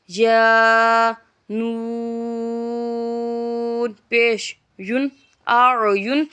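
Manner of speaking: slow chant, letters drawn out then joined; Qaida spelling drill. Shown as a demonstration. Teacher chanting letters for pupils, drawn-out sing-song spelling. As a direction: spell it out for a child learning Quran letters.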